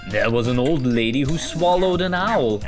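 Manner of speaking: ordinary delivery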